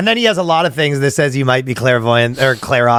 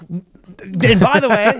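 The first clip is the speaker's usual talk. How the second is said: mock psychic voice; 7 semitones higher, much slower than usual. Mocking psychic aside.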